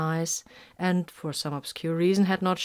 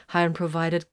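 ordinary delivery, nothing marked

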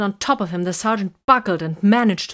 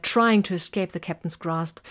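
ordinary delivery

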